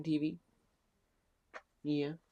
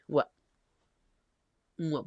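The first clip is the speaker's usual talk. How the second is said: ordinary talk, nothing marked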